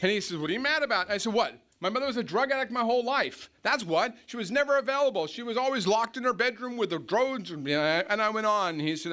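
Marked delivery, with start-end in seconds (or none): 0.0-7.5 mock indignant outburst. Retold outburst about his mother.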